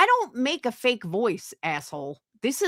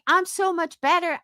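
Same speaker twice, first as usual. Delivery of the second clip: higher-pitched mimicked bragging; mock boasting; 8 semitones higher than usual. Denying she tells people she is better, mock boasting. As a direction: quote a brag in a mock high voice. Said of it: "told anybody".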